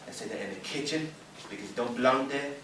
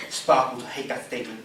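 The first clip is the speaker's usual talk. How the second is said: ordinary delivery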